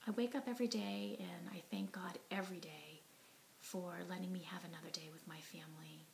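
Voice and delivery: ordinary talk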